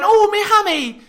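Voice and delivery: high whiny mock moan about a hamstring; mock injured player. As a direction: whine like a player clutching his hamstring. Mocking an injured cornerback's complaint.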